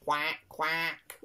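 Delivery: punchy, playful duck quacks; duck quacking. Acted. Nursery teacher quacking along with the children, playful duck voice. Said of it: "What does the duck say?".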